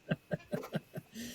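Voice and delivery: silent, airy burst of laughter; breathy laugh. Real, not acted. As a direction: laugh under your breath. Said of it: "I'm laughing because".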